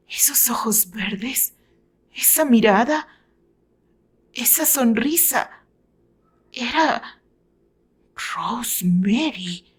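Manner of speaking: breathy, halting, starts in a whisper; words slipping out in shock; recognising a lost love, barely breathing; stunned murmur. A narrator's reading. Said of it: "El nombre resbaló de sus labios".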